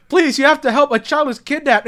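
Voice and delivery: high, loud mock begging; urgent plea. Child begs the third parent for help, mock-desperate plea. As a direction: imitate a desperate child pleading. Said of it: "a child tries to warn the adults".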